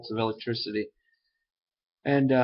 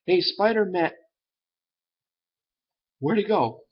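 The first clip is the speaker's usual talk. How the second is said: higher, louder mock call, then puzzled; 8 semitones higher, 4 dB louder than usual. Cop turning round to find Spider-Man gone, puzzled calling out. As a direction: call out to someone who has vanished.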